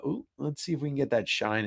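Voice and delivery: ordinary delivery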